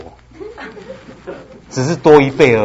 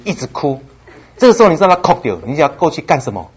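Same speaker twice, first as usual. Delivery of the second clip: usual speaking voice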